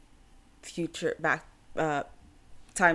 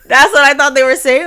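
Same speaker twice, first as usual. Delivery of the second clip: laughing; 5 semitones higher, 14 dB louder than usual. Real, not acted. Cracking up at mishearing.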